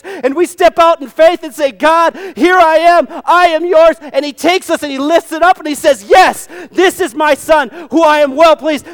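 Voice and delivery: loud, high preaching at full force. Acted. Preacher exhorting the congregation about faith, fervent.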